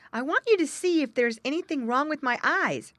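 a hint of a worried little animal. Jerry voiced in story.

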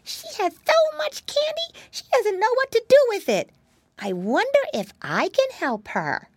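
very high, excited little pig voice; amazed piggy boy. Acted. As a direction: marvel in a squeaky-high child's pitch. Voices Flop marvelling.

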